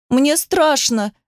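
put-on high, slow frightened child; drawn-out plaintive child's complaint; tearful fear. A narrator's reading. Frightened daughter to mother as she flees, tearful. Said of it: "заплакала дочь".

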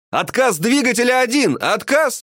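high-pitched alarmed call; pilot's shout. A narrator's reading. Pilot reporting engine failure mid-flight, urgent high-pitched shout. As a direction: cry out an emergency warning. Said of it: "закричал пилот".

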